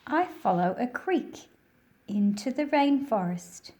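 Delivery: projected read-aloud narration; reading to children. A narrator's reading. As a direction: read the picture book out to a class of kids. Reads picture book aloud.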